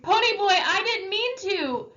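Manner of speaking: loud high desperate scream. Acted. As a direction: scream it after a running boy. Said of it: "Derry screamed".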